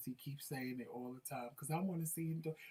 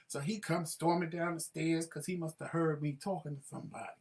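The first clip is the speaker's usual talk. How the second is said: usual speaking voice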